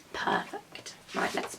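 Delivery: breathy whispered aside; teacher whispering to herself, soft and unvoiced. Real, not acted. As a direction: say it under your breath. Aside to self after a video.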